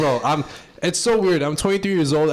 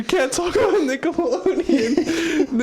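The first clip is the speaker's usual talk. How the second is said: laughing; 10 semitones higher, much slower than usual. Real, not acted. Still laughing.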